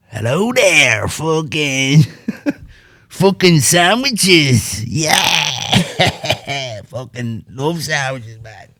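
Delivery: high, slow, drawn-out bad Irish mimicry; bad McGregor impression. Mocking McGregor's acting to a friend, hammed-up high voice. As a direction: ham up a bad imitation of McGregor. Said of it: "me doing a bad impression of McGregor".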